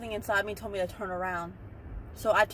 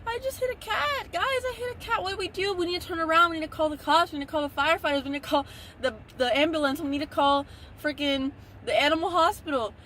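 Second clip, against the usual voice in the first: frantic, much higher voice, babbling in panic; panicked; 7 semitones higher than usual. Retold or imitated. Retelling her panic after hitting a cat, frantic and much higher. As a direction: replay the moment right after hitting the cat, voice shooting up. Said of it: "I was panicking, guys. I was crying".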